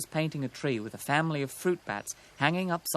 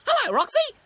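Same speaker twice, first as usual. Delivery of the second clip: high, loud, rapid magpie call; excited high-pitched hail; 8 dB louder, faster than usual. A narrator's reading. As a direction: call out in a piercing cartoon bird voice. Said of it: "Sticky called".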